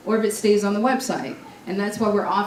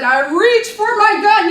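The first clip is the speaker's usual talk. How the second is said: higher, louder, slow dramatic line; dramatic reveal; 11 semitones higher, 8 dB louder, slower than usual. Retold or imitated. Speaker recalling her officer survival speech, dramatic and loud. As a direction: land it like a big suspense moment.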